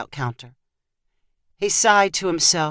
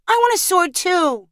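put-on much higher, wanting child's voice; little girl Sadie; 12 semitones higher than usual. A narrator's reading. Sadie wants a sword like her brother's, high and insistent. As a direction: pipe up like a small sister.

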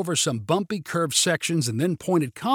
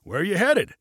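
pitched-up friendly hail; mild shouted question; 5 semitones higher than usual. A narrator's reading. Pilot asks hitchhiker's destination, mild shout. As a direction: call the question out lightly. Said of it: "shouted Junie".

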